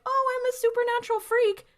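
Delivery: high, sing-out taunt; mocking imitation. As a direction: parody someone announcing themselves, pitched far up. Dean mockingly quoting Sam.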